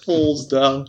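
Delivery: normal talking voice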